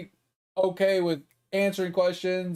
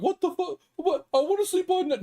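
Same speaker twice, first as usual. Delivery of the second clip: higher, whiny mock of the mom; mom's outraged whine, mocked higher; 10 semitones higher than usual. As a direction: mimic her indignant whine, pitched up. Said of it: "her tone would be like".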